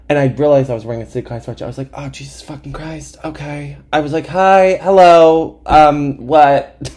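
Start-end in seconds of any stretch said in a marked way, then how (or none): 1.9-3.8 soft, higher exasperated aside; quietly dismayed to yourself; muttered dread. Retold or imitated. Dreading encounter, retold aside to self. 4.3-6.9 loud, high, stretched-out hello; hammed-up awkward hello; awkward greeting. Awkward greeting to stranger, retold.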